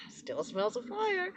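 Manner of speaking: high, slow, close to tears; teary quote. Retold or imitated. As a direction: let the words catch in a half-cry. Tearful quote of herself.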